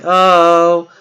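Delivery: drawn-out, held "oh" as the audience; imitating viewers. Streamer voicing viewers as the stream ends, imitating them. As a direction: mimic a let-down crowd with one long "oh". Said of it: "I know you're like".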